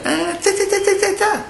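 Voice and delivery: very high-pitched rapid monkey jabber; monkey chatter. Acted. Monkeys answer the angry cap seller, shrill chattering. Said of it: "And the monkeys go".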